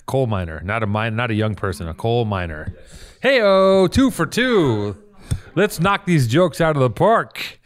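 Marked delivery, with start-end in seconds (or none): 3.2-7.6 loud, high Hey-o, drawn out; celebrating. Acted. Host cheering his own punchline, loud and high. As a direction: crow over the joke like a game-show host.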